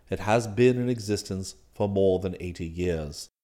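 low, soft, formal manservant voice; unruffled valet. Acted. Valet explains club rules to master, unruffled. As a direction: explain deferentially, deep and composed.

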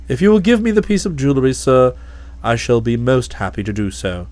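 low, calm, deferential butler voice; a low, imperturbable manservant; Jeeves the valet. Acted.

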